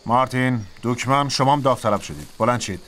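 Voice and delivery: giving orders. Acted. Picking soldiers by name.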